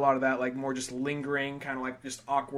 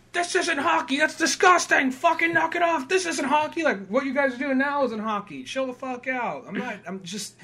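much higher, indignant scolding; outraged McCracken; 11 semitones higher, 3 dB louder than usual. Retold or imitated. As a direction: scold them like a prissy enforcer.

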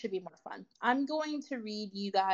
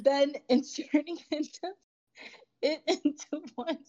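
higher, laughter-broken reading; reading while laughing; 5 semitones higher than usual. Real, not acted. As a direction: struggle to get the words out through laughter. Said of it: "Can you read it without".

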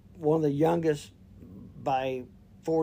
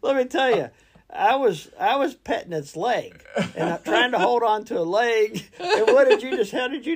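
higher and louder, words through laughter; laughing while talking; 9 semitones higher, 8 dB louder than usual. Real, not acted. Telling his friend about the deer, laughing through words. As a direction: talk through your laughter.

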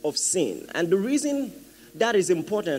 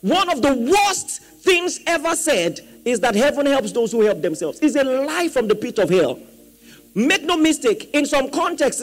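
raised, emphatic sermon voice; forceful preaching; 5 semitones higher, 4 dB louder than usual. Real, not acted. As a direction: drive each point home to the congregation.

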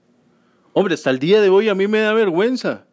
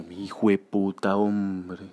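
groggy grumble; 10 semitones lower, 7 dB quieter, slower than usual. Retold or imitated.

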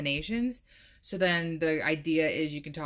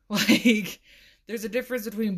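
laughing; 5 semitones higher, 6 dB louder than usual. Real, not acted. Laughing at her own joke.

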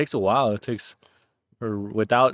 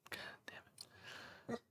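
whispered curse under the breath; whispering; 28 dB quieter, much slower than usual. Real, not acted. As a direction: hiss it under your breath. Muttering at the barking dog.

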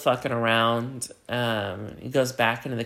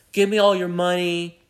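robber's demand; 6 semitones higher, 5 dB louder than usual. Retold or imitated.

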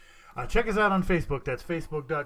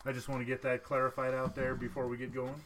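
usual speaking voice

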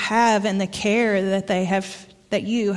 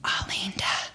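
Alinda breathed out as a hushed whisper; teacher's hushed word for pregnancy, whispered; 3 semitones lower, 10 dB quieter than usual. Retold or imitated. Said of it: "and he whispered, like my mom did".

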